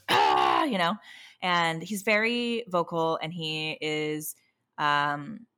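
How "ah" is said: loud, low throaty "ahh"; rough growl mimicking a frustrated child; imitated child's growl. Parent imitating child throwing toys in the box, frustrated growl. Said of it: "he goes".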